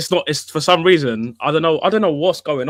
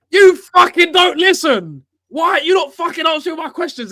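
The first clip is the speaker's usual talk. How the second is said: mock furious shout; 13 semitones higher, 5 dB louder than usual. Retelling the older fan losing it, hammed-up shout. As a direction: yell it like a fan who lost it. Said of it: "he got pissed off when he was like".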